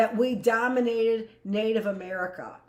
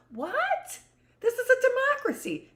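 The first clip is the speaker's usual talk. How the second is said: much higher, squeaky indignant voice; mock outrage. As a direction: shriek it like an offended know-it-all. Voicing an indignant objector.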